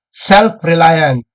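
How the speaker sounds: "Self-reliant" drawn out very slowly, falling at the end; slow emphatic word. Real, not acted. Lecturer stressing the key term to officials, very slowly. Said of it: "napaka-importante nito".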